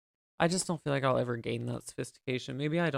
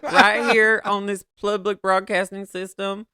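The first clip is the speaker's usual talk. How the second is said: high, loud and slow, like a grand announcement; hammed-up outrage; 7 semitones higher, 7 dB louder than usual. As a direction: stretch it out in mock indignation. Mock outrage, stretched out.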